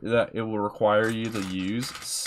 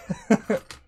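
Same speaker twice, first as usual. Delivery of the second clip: laugh; 10 semitones higher than usual. Real, not acted. Laughing at chat joke.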